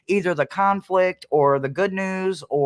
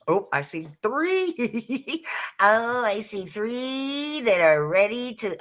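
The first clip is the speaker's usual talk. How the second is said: delighted surprise; 7 semitones higher, 3 dB quieter, slower than usual. Real, not acted. Spotting cards jumping out.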